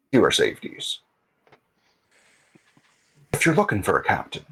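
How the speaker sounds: lightly voiced airship captain, pitch swinging up; a hint of an old hand, pausing between phrases; Captain Seamus. Captain offering his services to a player, lightly voiced role.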